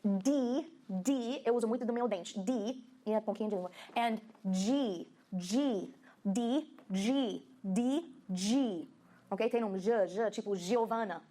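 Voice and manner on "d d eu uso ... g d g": slow, careful D and G; teacher contrasting D and G; D versus G drill. Shown as a demonstration. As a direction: say D and G carefully apart. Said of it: "pessoas têm dificuldade de ouvir a diferença".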